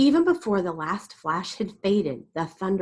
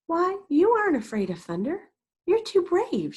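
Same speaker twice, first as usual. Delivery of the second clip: high grandma praise; 11 semitones higher than usual. Acted.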